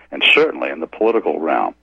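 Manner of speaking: ordinary talk, nothing marked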